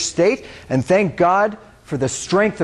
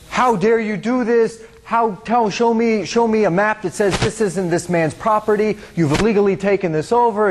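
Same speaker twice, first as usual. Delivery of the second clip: imitating a high, slowed, outraged activist; mock the accuser's righteous indignation; retold outrage, pitched high; indignant accusation; 8 semitones higher than usual. Activists confront soldier on camera.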